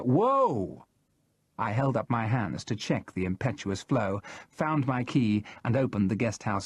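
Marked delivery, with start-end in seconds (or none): 0.0-0.9 a high, startled Whoa! with a different timbre. A narrator's reading. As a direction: pull up short, startled. Narrator stops the flow.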